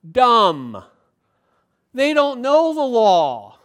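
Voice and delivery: loud, drawn-out mock contempt; scornful elites. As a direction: pronounce them stupid, loudly and slowly. Voices elites scorning believers.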